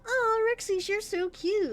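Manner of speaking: high, mock-adoring coo; mock cooing chat. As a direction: imitate chat gushing over her. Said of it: "and you guys are like".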